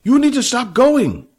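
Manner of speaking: very high, loud call after him; Fat calling. A narrator's reading. Fat calling after limping Billy, very high loud voice.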